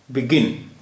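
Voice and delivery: Begin with the second syllable गिन leaned on; stress on second syllable. Shown as a demonstration. Teacher showing students how begin is said, second syllable stressed. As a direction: say begin, landing firmly on गिन. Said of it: "दूसरे syllable पर stress".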